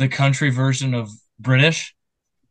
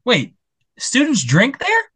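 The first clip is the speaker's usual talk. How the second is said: mock surprise; 10 semitones higher, slower than usual. Mock shock at the college news.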